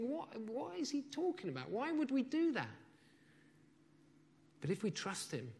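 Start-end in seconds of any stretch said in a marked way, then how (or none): 0.0-2.7 higher and softer, baffled disbelief; listener's baffled inner thought, high and puzzled; incredulous inner voice. Acted. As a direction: ask it as if the idea makes no sense. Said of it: "and you think".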